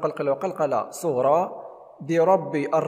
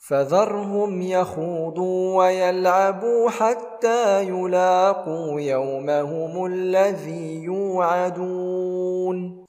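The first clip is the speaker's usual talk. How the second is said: slow, chanted tajweed recitation; measured tarteel chant; Quran recitation; 3 semitones higher, 4 dB louder, much slower than usual. Shown as a demonstration. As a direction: recite it melodically, drawing out the madd. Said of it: "هنا الراء مفخمة".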